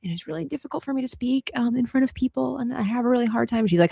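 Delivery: ordinary talk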